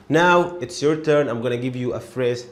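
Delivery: ordinary delivery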